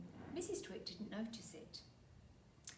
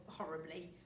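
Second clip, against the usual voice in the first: ordinary delivery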